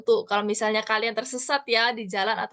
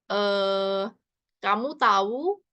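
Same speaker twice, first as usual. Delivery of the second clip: ordinary talk, nothing marked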